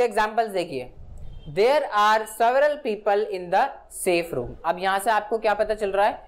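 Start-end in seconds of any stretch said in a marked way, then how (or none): 1.6-4.5 very slow, each word set apart; slow example read. Shown as a demonstration. Teacher reading an example sentence to the class, very slow.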